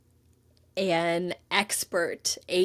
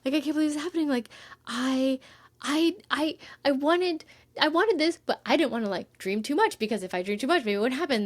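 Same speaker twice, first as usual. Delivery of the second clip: higher, breathless rush of happy words; can barely believe her luck, tumbling faster at the end; giddy disbelief; 5 semitones higher, much faster than usual. Retold or imitated. Retelling her reaction to the agent's email: giddy excited rush. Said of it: "so happy and I was".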